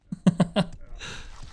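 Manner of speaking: a soft airy chuckle, almost silent; breathy laugh. Real, not acted. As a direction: laugh quietly through the breath. Laughs at his own guilt.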